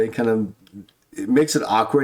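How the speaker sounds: normal talking voice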